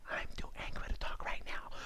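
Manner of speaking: barely audible whisper. Shown as a demonstration. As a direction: whisper it as a hushed echo. Same phrase repeated whispered. (chuchoté, presque inaudible)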